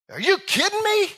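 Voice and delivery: high, put-on disbelieving voice; mock exasperated God. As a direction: play a God who lost his cool. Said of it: "Do not think that God one day looked down and goes".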